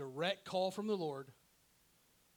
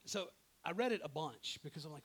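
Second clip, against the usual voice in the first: ordinary delivery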